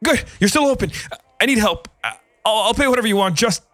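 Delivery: high, panicky pleading; desperate and scared, words tumbling out; frightened. A narrator's reading. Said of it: "wild with fear and confusion".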